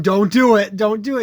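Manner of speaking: hammed-up plea; mock pleading. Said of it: "you go".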